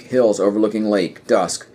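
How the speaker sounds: normal talking voice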